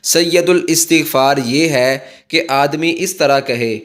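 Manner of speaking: ordinary talk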